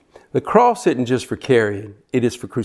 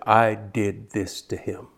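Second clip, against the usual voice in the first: softer, slow admission; owning it in a hushed voice; quiet confession; 3 semitones lower, 7 dB quieter, slower than usual. Shown as a demonstration. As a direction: let it sink in, softly and slowly. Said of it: "let that stick in your spirit and just take ownership".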